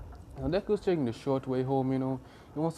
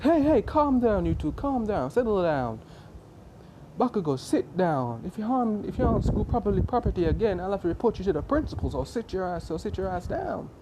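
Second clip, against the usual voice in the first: higher, raised-voice telling-off; scolding teacher; 6 semitones higher, 5 dB louder than usual. Acted. Teacher breaking up Bakugo and Deku, sharply scolding. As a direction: order them down like an exasperated teacher.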